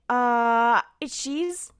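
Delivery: awkward stalling excuse. Retold or imitated. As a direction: fumble through a made-up excuse. Acted stalling excuse on phone.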